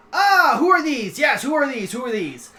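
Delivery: high, loud, excitable dragon ruler; King Sideshow. Acted. Dragon king notices newcomers, high excitable questioning. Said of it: "King Sideshow looks at you, he goes".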